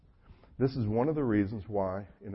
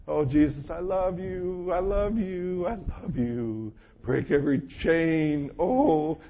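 slowed, higher, swooning worship voice; hammed-up worship, drawn out and higher; mock worshipper; 6 semitones higher, slower than usual. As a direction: gush it like someone lost in a praise song. Imitating a worship singer.

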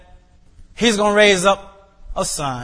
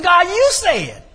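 pleading prayer; 12 semitones higher, 3 dB louder, slower than usual. Retold or imitated. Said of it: "I said".

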